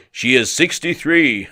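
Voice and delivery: slow, loud mock German reply; German accent. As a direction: answer slowly and loudly in a German accent. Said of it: "it was in German accent".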